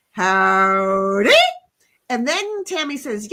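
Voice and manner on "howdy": slow cowboy howdy. Acted. Reading a chat greeting in character.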